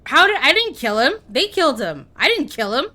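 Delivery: disbelieving protest. Real, not acted. Protesting the unexpected kill.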